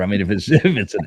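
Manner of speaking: ordinary talk, nothing marked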